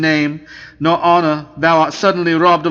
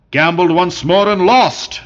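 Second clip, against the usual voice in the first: wild cry; 2 semitones higher than usual. A narrator's reading. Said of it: "cried the Marquis wildly".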